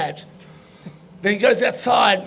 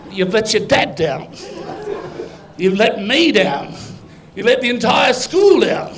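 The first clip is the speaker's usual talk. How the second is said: lightly acted disappointed teacher; scolding teacher. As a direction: scold in measured, emphatic punchline beats. Teacher scolding the inflatable boy.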